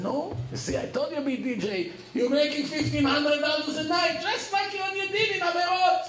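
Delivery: excited I-told-you-so; triumphant told-you-so. Retold or imitated. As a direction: crow that you were right all along. Gloating to the boy now a DJ.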